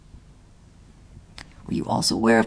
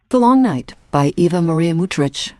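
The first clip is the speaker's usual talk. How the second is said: loud, clear radio announcer read; credits announcement; 3 semitones lower, 4 dB louder, slower than usual. Acted. Announcer reads the cast list to listeners.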